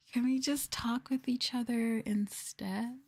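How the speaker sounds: cute voice. Acted. Playful mock-sweet ASMR plea.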